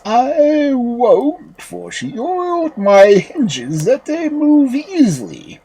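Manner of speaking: high, slow, drawn-out door voice; talking door. A narrator's reading. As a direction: refuse slowly, stretching words like creaky hinges. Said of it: "But the door answered".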